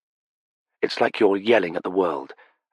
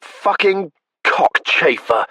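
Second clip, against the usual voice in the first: slow, high, loud, savoring each syllable; delighted relish; 13 semitones higher, 5 dB louder, slower than usual. Acted. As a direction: chew each word with delight. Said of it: "slow, delighted relish".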